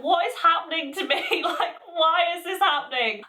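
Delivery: high, laughing bewildered question; laughing in disbelief. Retold or imitated. Retelling her bewildered reaction to her teacher, laughing. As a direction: laugh through the confusion. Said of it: "I was like".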